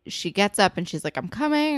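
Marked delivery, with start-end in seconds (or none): 1.2-1.8 calling out. Retold or imitated. Calling out to the doorbell.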